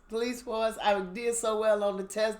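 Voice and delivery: ordinary talk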